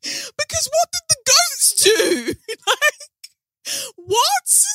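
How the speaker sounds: very high, squealed through laughter; laughing disbelief. Real, not acted. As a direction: protest helplessly while cracking up. Cracking up over the killed goats.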